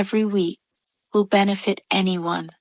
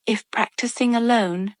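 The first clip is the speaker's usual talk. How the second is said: normal talking voice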